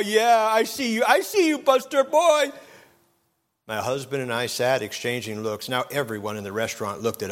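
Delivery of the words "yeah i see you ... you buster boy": put-on high, loud sing-song cooing at the baby; drunk old bum cooing at a baby. Drunk bum in a restaurant teasing the baby, high loud coo. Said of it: "flapping his hands and saying".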